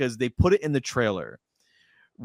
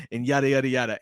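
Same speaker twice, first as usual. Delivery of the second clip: ordinary talk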